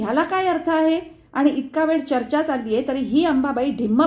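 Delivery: high, loud sarcastic lecture; enraged mother lecturing family; furious. A narrator's reading. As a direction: rant in disgust. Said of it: "संतापून म्हणाली".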